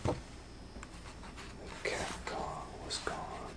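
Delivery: soft low whisper; whispered ending. A narrator's reading. Doctor Varmint whispering the moral at the end.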